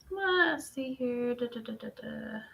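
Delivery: soft, drawn-out self-talk trailing into da-da-da; absent-minded murmur. Real, not acted. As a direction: murmur to yourself while searching. Mumbling to herself while searching screen.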